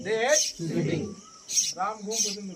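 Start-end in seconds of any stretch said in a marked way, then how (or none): 0.0-1.0 drawn out, with the s of hates clear; slow model sentence. Shown as a demonstration. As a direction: say "hates" slowly for the class. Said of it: "সবাই pronounce কর".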